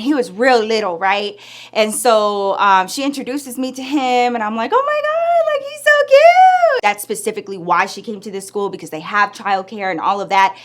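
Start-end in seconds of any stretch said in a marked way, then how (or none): 4.7-6.8 high-pitched drawn-out squeal of adoration; gushing. Retold or imitated. Meeting a friend's little son, gushing squeal. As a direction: coo loudly, way up high, stretching it out.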